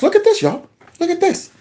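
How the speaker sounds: high excited call to watch; excited look-at-this. Real, not acted. Showing viewers the clip, high and eager. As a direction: point them at the clip, pitch way up.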